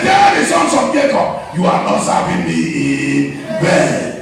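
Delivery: high, drawn-out revival preaching; full-force preaching. Real, not acted. Preacher proclaims a month of restoration to the church, full-force preaching. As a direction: build a loud proclamation, stretching key words.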